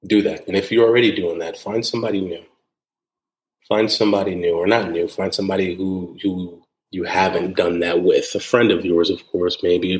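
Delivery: normal talking voice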